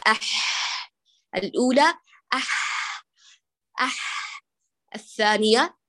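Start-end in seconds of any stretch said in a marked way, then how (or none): 0.1-4.4 "أح" repeated with the ح as unvoiced throat friction; ح in "أح" whispered, held and hoarse; demo ha. Shown as a demonstration. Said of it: "سامعين؟".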